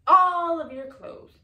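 "all of your clothes" loud, high and slow; emphatic list ending. Acted. Woman lists what went to the curb, "all" drawn out loudly. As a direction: stretch "all" out loudly and pointedly.

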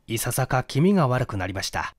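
plain reading voice

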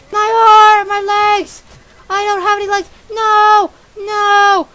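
high, loud cartoon wailing; mock agony. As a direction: cry out over lost limbs, hammed-up. Character loses limbs in game.

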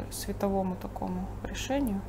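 ordinary talk

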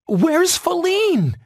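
very high, drawn-out question; young fawn Bambi. A narrator's reading. Bambi to Gobo, looking for Feline, high-voiced asking. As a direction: stretch it out high and slow.

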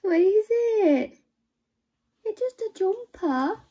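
high, slow cooing. Real, not acted. Mum cooing at the baby, high baby talk.

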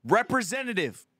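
high-pitched, insistent demand; mock yelling. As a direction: cut across the phone menu, pitch jumping up. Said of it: "How come yelling representative".

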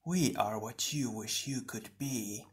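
soft, slow sinister shadow; hushed, drawn-out menace; slithering voice. A narrator's reading. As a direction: slither through it like a water snake. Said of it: "like the slithering of water snakes".

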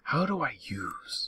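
slow unvoiced whisper of confusion. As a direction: breathe the question out slowly, puzzled.

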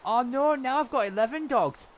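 loud, high comic panic; high-pitched dismay. Real, not acted. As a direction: cry out in sudden dismay. Sudden dismay at dog count.

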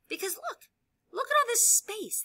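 usual speaking voice

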